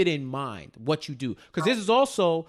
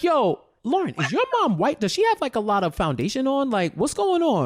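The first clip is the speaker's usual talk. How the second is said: high, louder teasing disbelief; mock incredulous; 8 semitones higher, 4 dB louder than usual. Viewer quizzing Lauren about her mom, high and teasing. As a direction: toss it off in a raised, loud mock voice. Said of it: "I'm like".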